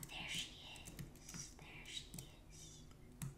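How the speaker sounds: very slow, drawn-out whisper; hushed, stretched-out stalking whisper; stealthy whisper. Real, not acted. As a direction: whisper it while creeping up on prey. Sneaking up on a player.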